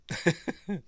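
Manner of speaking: high little chuckle; short real giggle; amused laugh. Real, not acted. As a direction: snigger at your own remark. Amused at the view.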